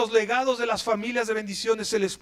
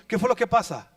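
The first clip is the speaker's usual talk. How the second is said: normal talking voice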